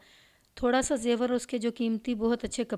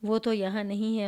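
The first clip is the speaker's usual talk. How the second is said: ordinary delivery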